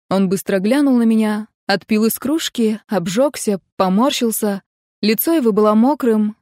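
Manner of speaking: ordinary delivery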